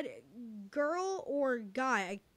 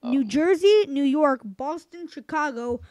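game show host; 8 dB louder than usual. Acted. Quiz host reading options.

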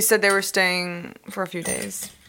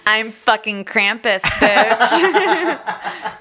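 slow tough-guy line through laughter; laughing mock menace; 2 semitones higher, 4 dB louder, much slower than usual. Quoting the grandma's line, laughing. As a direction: growl it out but crack up. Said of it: "He said".

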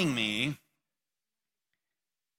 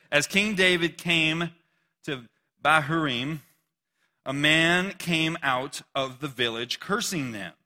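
scripture reading; 5 dB louder, slower than usual. Acted. Pastor reads scripture aloud.

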